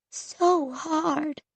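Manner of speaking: soft, high sad murmur. A narrator's reading. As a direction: let it fall in sorrow. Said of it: "Grandma Miriam said sadly".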